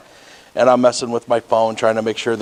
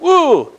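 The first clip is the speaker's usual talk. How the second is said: high, loud whoop of alarm; a sudden "woo!" as the brakes go on; startled woo; 5 dB louder than usual. Retold or imitated. Driver cut off by a BMW, startled whoop. Braking as car cuts in.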